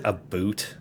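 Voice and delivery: "boot" said with an oo vowel; imitated Canadian about. Colleague teasing the host's vowels, "boot" with oo. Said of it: "he did say both a gain and a boot".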